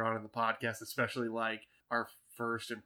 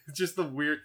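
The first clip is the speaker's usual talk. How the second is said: usual speaking voice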